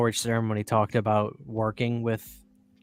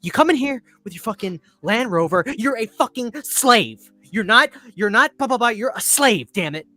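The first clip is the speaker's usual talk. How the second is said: higher, louder, ranting Tarantino rebuke; Tarantino impression; 8 semitones higher, 5 dB louder than usual. Podcaster imitating Tarantino scolding Jamie Foxx, ranting high and loud. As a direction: rant like an outraged director scolding an actor. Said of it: "his impression of Tarantino was great".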